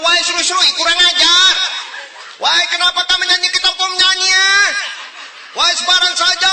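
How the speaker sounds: high, furious yelling at a rival; hammed-up raging shout; angry shouting. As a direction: bellow it in outraged protest. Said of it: "bapak kenapa marah-marah".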